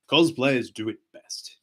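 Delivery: ordinary talk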